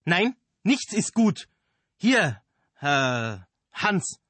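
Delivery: high, loud and slow indignation; angry retort. Acted. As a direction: drag it out indignantly. Director snaps at the actor.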